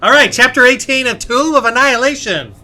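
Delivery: loud, high, hyped announcement; exciting intro. Shown as a demonstration. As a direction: announce it with big energy. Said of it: "Trying to make this intro a little more exciting".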